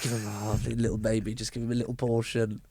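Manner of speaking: put-on soft baby talk. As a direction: coo it like serving a baby. Mocking a barmaid's tiny pour.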